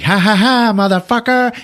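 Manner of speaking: high, loud gloating cackle; hammed-up laughing taunt; mock triumphant laugh. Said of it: "And he's laughing like".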